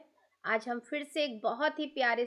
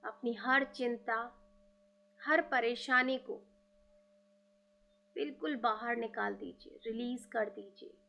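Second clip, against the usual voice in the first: guided meditation; 2 semitones lower than usual. Acted. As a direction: lead listeners gently inward. Guided meditation invoking gurus.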